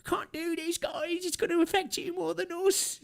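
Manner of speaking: high, put-on whining rich man; whiny mock billionaire. Streamer mocking crying billionaires on TV, high whiny mock voice. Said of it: "billionaires go on T V and be like".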